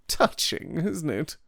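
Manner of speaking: dry mocking aside; sardonic. A narrator's reading. Devine mocks the reunion, dry and sardonic. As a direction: sneer lightly at the scene. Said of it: "said Devine".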